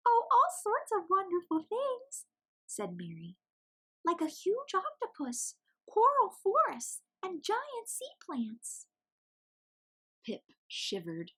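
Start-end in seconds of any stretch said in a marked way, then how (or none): 0.1-8.9 much higher, squeaky chick voice; squeaky little penguin. A narrator's reading. Mary's held voice.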